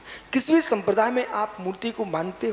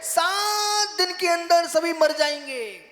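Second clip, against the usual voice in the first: very high and slow, every word weighed; slow solemn pronouncement; 12 semitones higher, slower than usual. Shown as a demonstration. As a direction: drop the prophecy like a verdict. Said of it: "मैं श्राप नहीं दे रहा हूं". (धीमी, ऊँची, गंभीर घोषणा)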